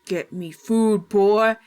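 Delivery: slow, loud, menacing growl; menacing monster voice. Acted. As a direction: demand it like a starving monster. Monstrous grandpa demands food.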